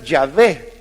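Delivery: Yahvé said slowly and clearly, as yah-VEH; careful pronunciation. Shown as a demonstration. Preacher showing the congregation how to say Yahvé, careful pronunciation. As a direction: lay out Yahvé syllable by syllable, stress on VÉ. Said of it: "que la pronunciación y la traducción correcta del nombre de Dios tendría que ser".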